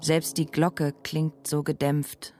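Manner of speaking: ordinary delivery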